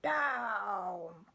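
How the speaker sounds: a witch's sharp call from the sky; witch crying out. Acted. Witch ordering her broom down, high cry. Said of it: "cried the witch".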